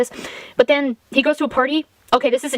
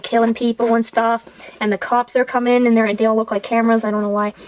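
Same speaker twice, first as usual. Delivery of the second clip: ordinary talk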